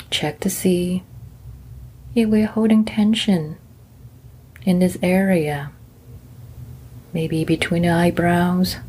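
gentle meditation guide; guided meditation. Acted. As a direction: ease them into calm, one body part at a time.

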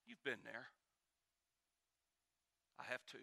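ordinary delivery

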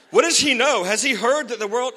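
high, loud comic worry; mock alarm. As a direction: blurt it out as alarmed gossip, hammed up. Mimics the alarmed listeners, joking.

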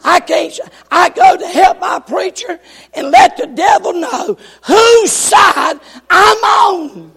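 high, loud shout, slowing at the end. Retold or imitated. Deaf old woman shouting to help her preacher, loud. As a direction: holler it so the devil knows. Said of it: "And she shouted".

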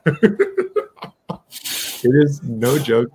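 slow words pushed through a real laugh. Real, not acted. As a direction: laugh it out, barely able to talk. Laughing while telling the bet.

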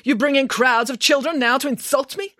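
high, offended director voice; incredulous, high-pitched outrage; indignant demand. Acted. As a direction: protest as a pompous, insulted official. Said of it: "demanded the director".

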